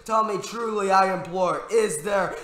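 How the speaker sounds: high, loud, half-sung imploring; desperate impassioned plea. A narrator's reading. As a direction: build to a ringing desperate shout. Desperately begging the raven.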